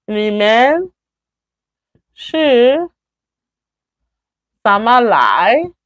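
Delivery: each chunk set apart by long pauses; slow model question. Shown as a demonstration. Teacher modelling example three, slow and chunked. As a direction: lay the question out slowly word by word. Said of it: "The third one".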